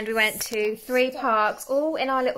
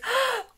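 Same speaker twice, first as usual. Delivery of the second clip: imitated excited gasp; 11 semitones higher than usual. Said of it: "She goes".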